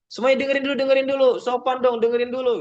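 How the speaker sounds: high-pitched, insistent nagging; mock angry scolding. Shown as a demonstration. Teacher telling everyone to be polite and listen, angry scolding. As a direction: demand attention as a cross teacher. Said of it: "nggak usah marah-marah".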